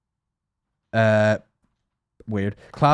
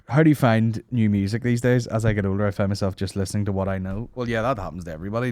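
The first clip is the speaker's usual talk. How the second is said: usual speaking voice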